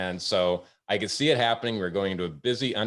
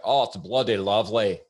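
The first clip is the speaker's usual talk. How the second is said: hammed-up Scottish lilt, louder; Scottish accent; 5 dB louder than usual. Waiter imitating a Scottish guest praising scotch, hammed-up Scottish accent. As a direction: imitate a Scotsman, roughly. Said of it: "not my best Scottish accent".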